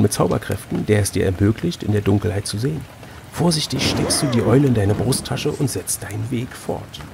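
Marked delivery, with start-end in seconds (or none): none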